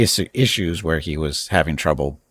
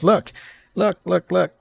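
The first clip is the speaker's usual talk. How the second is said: much higher, eager repeated look; excited nagging wife; 3 dB louder, slower than usual. Retold or imitated. Wife pushing her phone at husband, high excited look. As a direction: mimic the wife insisting he watch.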